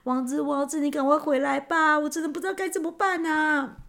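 high, pleading inner cry; anxious praying. A narrator's reading. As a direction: beg for rescue, voice rising as it goes. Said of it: "心里祈祷着". (焦急地祈求，声音越来越高)

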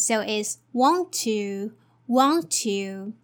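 "want to" with one t, wan-tu; linked want to. Shown as a demonstration. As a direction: join "want to" on a single t. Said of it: "we only have to pronounce the t sound once".

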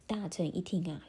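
usual speaking voice

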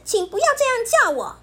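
high, fast, upset shout; loud protest. Acted. Bear cub shouts at Pipi to stop the nickname. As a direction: push back hard, hurt and angry. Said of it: "他大声地对皮皮说".

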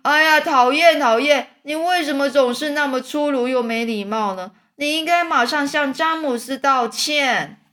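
high, loud scolding earthworm; indignant shout. A narrator's reading. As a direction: cry out in annoyance and demand an apology. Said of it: "这时候蚯蚓大叫说". (生气地高声大叫)

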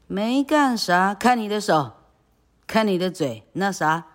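high, loud disbelieving scold; 高声质问. Acted. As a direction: pounce on his lie. Aunt scolding.

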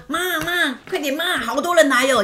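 high, urgent call to mom; a kid yelling for mom to come see; excited child calling. Retold or imitated. Kid calling her mom about visitors, high and excited.

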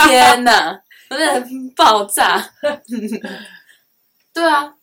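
loud laughing exclamation. Real, not acted. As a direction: burst out laughing at yourself. Laughs at her own past. (大笑着惊叹)

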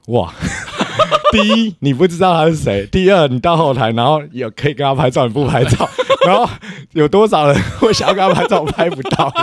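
loud, high exclamation broken by laughter; laughing disbelief. Retold or imitated. Speaker marvelling at girlfriend who refused the photo, laughing loudly. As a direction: marvel at her through laughs.

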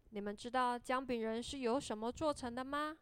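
ordinary delivery, nothing marked

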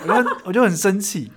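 laughing while retelling anger. Retold or imitated. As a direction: laugh through the memory of anger.

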